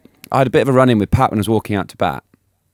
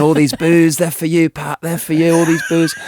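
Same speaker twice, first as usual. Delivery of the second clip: lightly voiced taunt, higher; taunting a bowler, a touch higher; mock taunting; 8 semitones higher, 4 dB louder than usual. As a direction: tease the bowler, sing-song jeer. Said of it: "I was calling Pat everything".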